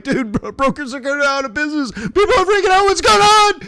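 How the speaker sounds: mock freak-out, voice climbing with alarm; alarmed caller pushing for answers, building up to high and loud; retold panic. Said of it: "I'm like".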